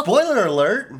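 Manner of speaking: high, loud mock alarm; teasing exclamation. Shown as a demonstration. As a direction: shout it up high to tease her. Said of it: "I'm joking".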